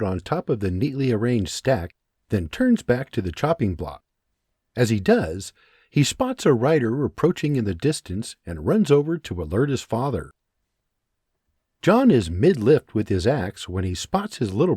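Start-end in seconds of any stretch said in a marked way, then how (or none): none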